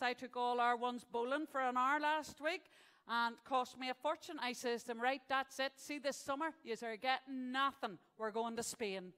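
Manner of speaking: loud, indignant woman laying down the law; exasperated mother's rant. Acted. Mother telling the kids about the summer, exasperated.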